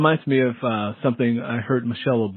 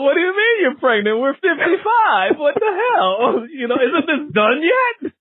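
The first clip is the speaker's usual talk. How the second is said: mock exasperation; 13 semitones higher than usual.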